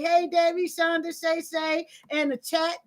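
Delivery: ordinary talk, nothing marked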